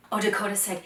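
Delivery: plain reading voice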